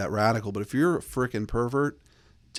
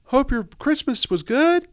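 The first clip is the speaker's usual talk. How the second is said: very high, slow sing-song email voice; hammed-up bubbly holiday greeting; mock chirpy greeting; 13 semitones higher, 4 dB louder, slower than usual. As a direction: overdo the cheer with exclamation points. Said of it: "Don't be like".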